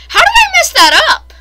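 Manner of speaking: high, loud cry of disbelief; exasperated yell. Real, not acted. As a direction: yell in exasperation at your own fail. Fails a parkour jump.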